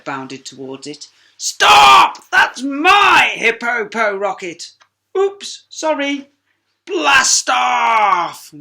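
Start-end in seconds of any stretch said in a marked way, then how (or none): 1.4-4.7 very high, loud desperate yell; frantic shout. Acted. Hercules shouts. 5.2-6.3 sheepish oops. Acted. Sheila sheepish. 6.9-8.5 high, loud launch yell; triumphant shout. Acted.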